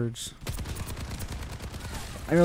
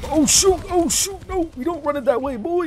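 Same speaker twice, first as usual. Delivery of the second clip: high-pitched mock panic; playful alarm; 8 semitones higher than usual. Player reacting to sudden danger, playful alarm. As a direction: yelp it high, playing at alarm.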